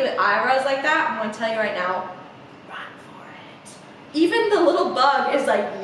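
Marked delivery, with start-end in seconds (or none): 2.7-3.7 hushed, confidential whisper; mock-urgent whisper; whispered warning. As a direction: whisper it like secret advice. Comic warning to young listeners.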